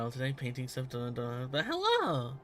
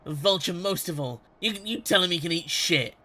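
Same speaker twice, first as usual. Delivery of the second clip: angry shout; 3 semitones higher, 7 dB louder than usual. Acted. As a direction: bellow it with contempt. Captive shouting insults.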